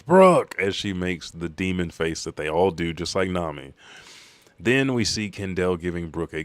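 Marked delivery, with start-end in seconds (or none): 0.0-0.6 sharp, high scolding shout; loud, high burst of indignation; outraged yell. Retold or imitated. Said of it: "turns around and yells".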